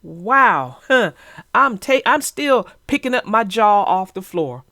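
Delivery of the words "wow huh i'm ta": ordinary talk, nothing marked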